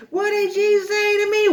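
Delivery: extremely high mock shriek; hammed-up screech of outrage; lit-up babysitter. Babysitter cuts off threatening toddler, shrieked mock outrage. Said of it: "she just lit up".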